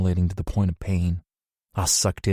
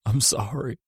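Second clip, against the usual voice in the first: bawling; 3 semitones higher than usual. A narrator's reading. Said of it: "I bawled".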